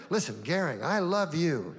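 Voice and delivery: ordinary talk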